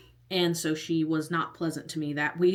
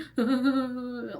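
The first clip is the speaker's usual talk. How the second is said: quick, higher-pitched imitation of bawling; mock sobbing; 6 semitones higher than usual. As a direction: act out a full-on cry, wordless and exaggerated. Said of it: "like, full on".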